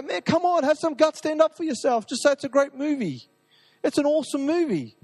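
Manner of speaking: high-pitched, pleading push to have some guts; exasperated urging. Retold or imitated.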